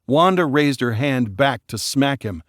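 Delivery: ordinary delivery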